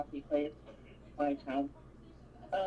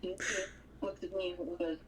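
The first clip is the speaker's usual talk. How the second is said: normal talking voice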